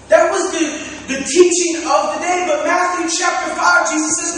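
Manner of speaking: loud, high, driving sermon delivery; full-force preaching. Acted. Preacher on loving those who hate you, full-force preaching. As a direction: drive each phrase home, stretching key words.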